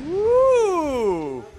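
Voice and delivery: long high whoop, sliding down; high-pitched admiring squeal; excited ooh. Real, not acted. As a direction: let out a loud, high "ooh" of delight. Admiring a new dinosaur.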